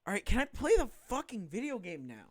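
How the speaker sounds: fed up and itching to get going; exasperated. Real, not acted. Gamer to the cutscene about playing now, exasperated. Player impatient with the long cutscene.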